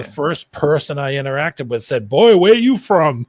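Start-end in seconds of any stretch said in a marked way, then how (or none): 2.1-3.3 loud, high, drawn-out mock Southern accent; imitated Southerner. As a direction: exclaim it like a nosy Southern local. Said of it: "the first time I went to the South".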